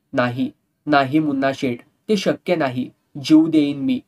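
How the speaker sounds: usual speaking voice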